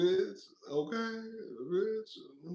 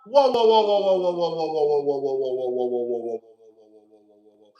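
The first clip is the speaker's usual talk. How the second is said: fading whoa; 9 semitones lower than usual. Pretend. Holding back critics of Pinky, a fading chant of whoa. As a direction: let each whoa die away like a voice walking off.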